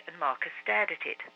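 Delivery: ordinary delivery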